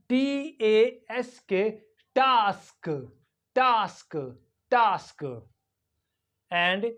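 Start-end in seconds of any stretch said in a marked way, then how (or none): 2.1-5.2 TASK as 'tahsk', long open vowel; British long A. Shown as a demonstration. Teacher drilling British English, long-ah TASK. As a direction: say task with the A of father. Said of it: "in British English".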